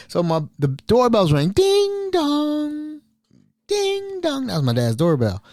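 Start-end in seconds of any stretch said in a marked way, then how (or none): 1.5-3.0 higher, sung two-note ding dong; doorbell chime. Retold or imitated. Announcing the doorbell as Mel arrives, high sung chime. 3.7-4.5 even higher falling ding dong; doorbell chime again. Retold or imitated. The doorbell rings a second time, high falling chime.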